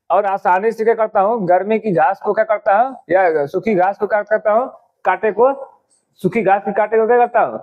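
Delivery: ordinary talk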